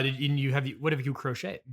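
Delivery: ordinary delivery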